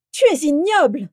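furious, high-pitched loud cry; roaring. A narrator's reading. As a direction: roar it in outrage. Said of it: "rugit Vera". (rugissement furieux)

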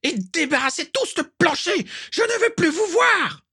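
very high shout building to a scream; screaming dismissal. Acted. Walburga screaming at everyone to leave, rising shriek. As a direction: drive them all out with a scream.

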